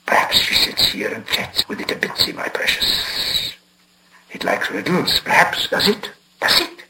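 whispered, fawning creature voice; polite creature whisper. A narrator's reading. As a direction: wheedle in a hissing whisper. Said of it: "became quite polite".

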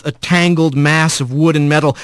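ordinary delivery, nothing marked

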